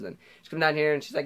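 plain reading voice

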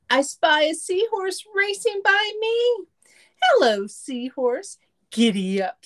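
sing-song storybook reading; reading to children. A narrator's reading. Miss Chris reading a picture book to children, sing-song. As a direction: lilt it for little listeners.

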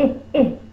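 I as a short smiling vowel, said twice; short smiling I, repeated; short I sound. Shown as a demonstration. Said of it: "The next sound is I".

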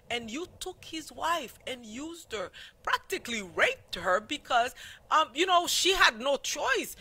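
prophet gently confronts the king; gentle rebuke. Acted. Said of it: "And Nathan gently says".

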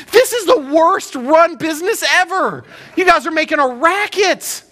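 high-pitched comic outrage; mock indignation. Rafter made to lift the boats, hammed-up indignation. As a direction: complain like a cheated customer, playing it for laughs. Indignant complaint about outfitter.

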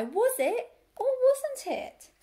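high, loud, drawn-out demand; barked. A narrator's reading. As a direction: lose patience and bark it slowly. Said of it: "barked Fly".

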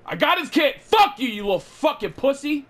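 loud, high, slowed taunting shout; gloating victory yell; trash-talk yell. Real, not acted. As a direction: scream insults at the beaten player. Gamer trash-talking a killed opponent.

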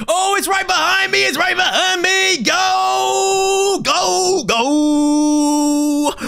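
high panicked shout; being chased. Real, not acted. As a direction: yell it with the monster on your heels. Monster right behind.

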